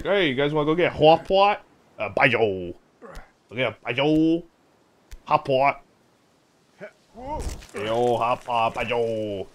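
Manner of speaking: loud, lifted imitation of an unidentifiable accent; mock accent, swelling into high, drawn-out calls; Axel's accent. Joking with friends about going out to eat, imitated Axel accent. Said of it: "we're like".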